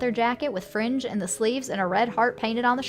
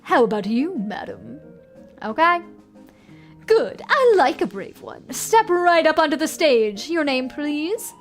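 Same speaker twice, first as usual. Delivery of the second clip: louder, higher theatrical ringmaster; showman; 4 semitones higher, 5 dB louder than usual. Acted. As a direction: coax a volunteer like a sly showman. Showman picks volunteer.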